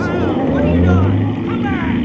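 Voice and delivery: loud panicked call; alarmed shout. Acted. Left on the road, shouting after the detective as he drives off.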